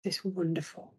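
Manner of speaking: ordinary talk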